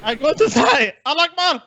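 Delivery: mock panicked passenger. Mocking the panicking passenger clip.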